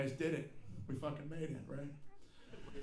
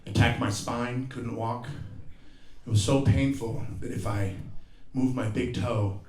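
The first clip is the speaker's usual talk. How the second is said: normal talking voice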